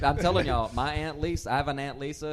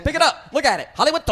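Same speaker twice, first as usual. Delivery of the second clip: louder, much higher pushy promo yell; mock hawker shout; 10 semitones higher, 11 dB louder, faster than usual. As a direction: throw the flyers at them with a shout.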